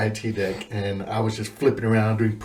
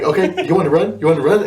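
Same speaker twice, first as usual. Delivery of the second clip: higher-pitched taunting question; mock J-hat; 6 semitones higher, 3 dB louder, faster than usual. J-hat to recruits, raised-pitch challenge. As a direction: taunt as a retold drill instructor. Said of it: "And he was like".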